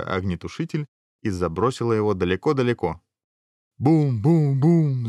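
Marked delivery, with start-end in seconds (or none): 3.8-5.1 slow, drawn-out high booms; imitated rumbling boom. A narrator's reading. Narrator imitating a crash in the mountains, slow booming. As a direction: sound out each boom loudly and slowly. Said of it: "загрохотало в горах".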